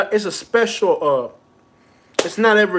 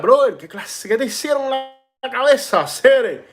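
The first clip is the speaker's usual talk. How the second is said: higher-pitched Spanish ribbing; mock teasing friend; 6 semitones higher, slower than usual. Regulars in the bodega rib him about his head, higher mock teasing. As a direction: tease him about his head, half-laughing in disbelief. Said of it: "They're like".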